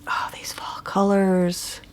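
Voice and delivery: hushed, breathy whisper of delight; awed whisper. Real, not acted. Stitcher admiring her floss colors for viewers, whispered. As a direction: whisper it like you can't believe how pretty.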